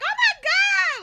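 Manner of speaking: very high, loud oh my god; loud gushing. Retold or imitated. Her own loud fan greeting imitated, very high and loud. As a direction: blast it out.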